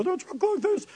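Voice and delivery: very high, soft squeaky voice; mock high-pitched little voice; not manly. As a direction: put on a tiny falsetto. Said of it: "Try to talk with your deep".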